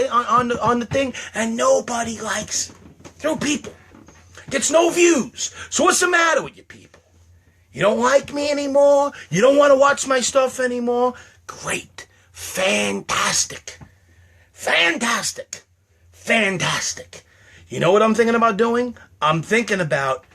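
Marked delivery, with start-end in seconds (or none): 3.2-11.1 high, wounded complaint; sulky, pitch raised throughout; mock-hurt whining. Mock-wounded rant at viewers. 11.5-17.2 slow, dry sarcasm; deadpan fake praise; sarcastic. Sarcastic reaction to no views.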